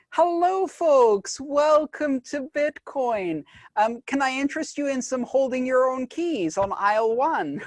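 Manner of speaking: high-pitched store greeter voice; Walmart greeter. Pretend. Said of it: "I'm the guy at the front door going".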